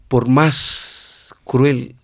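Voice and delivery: slow, measured words with long pauses; solemn quoted pronouncement. Retold or imitated. Quoting the Pope's words to Ortega, solemn.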